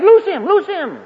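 high, loud acted order; urgent command. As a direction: call out to bystanders urgently. Acted command after Lazarus comes out.

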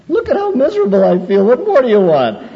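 hammed-up wail of misery; mock self-pity. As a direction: ham up the wallowing sinner.

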